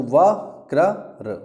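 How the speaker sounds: वक्रर drawn out very slowly, each sound clear; slowed teacher's वक्रर; slow careful demo. Shown as a demonstration. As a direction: spell out वक्रर slowly for students. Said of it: "सही उच्चारण बहुत ज़रूरी है".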